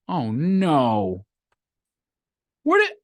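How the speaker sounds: acted panic, voice leaping high; mock dismay. You go into your vault for the money, mock dismay. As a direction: play-act discovering a loss. Acts out finding money gone.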